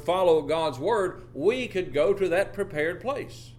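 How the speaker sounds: preacher drives home the prepared place; full-force preaching. Acted. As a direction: build it up like a pulpit climax. Sermon climbs to full force.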